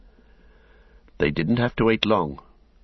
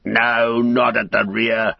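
loud, high orc bellow; slave driver's shout; 5 semitones higher, 5 dB louder, slower than usual. A narrator's reading. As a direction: shout a correction down the line. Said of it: "the slave driver shouted".

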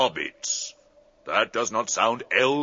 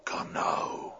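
hushed; 8 dB quieter than usual. Acted. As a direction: Come now said breathy and hushed, quiet. Treebeard takes in sad news.

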